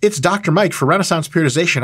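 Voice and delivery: ordinary talk, nothing marked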